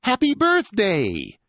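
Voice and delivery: loud, high, drawn-out birthday cheer; family shout. Acted. Whole family shouting birthday wishes at the girl, loud. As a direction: cheer it slowly to surprise her. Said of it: "The whole family shouts".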